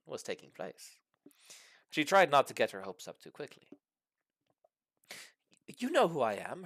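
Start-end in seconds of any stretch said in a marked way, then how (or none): 5.7-6.6 replying. Acted. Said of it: "Herbert replied".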